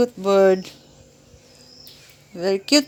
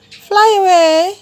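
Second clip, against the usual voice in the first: slow high coaxing; 6 semitones higher, 5 dB louder, slower than usual. Real, not acted. Coaxing a bird to fly.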